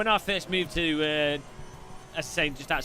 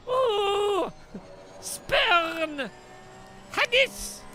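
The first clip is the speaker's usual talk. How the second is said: much higher, slow hammed-up Scottish accent, banishing; mock Scottish shout; much slower than usual. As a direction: roar it out like a cartoon Scot turning someone away. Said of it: "It's literally how they talk in Scotland".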